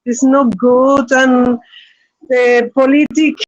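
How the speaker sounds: normal talking voice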